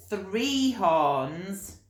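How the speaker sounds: usual speaking voice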